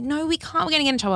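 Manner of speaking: high-pitched mock-anxious protest; high, lightly panicky imitation; worried friends. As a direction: imitate fretful, innocent schoolgirls. Voicing worried innocent school friends.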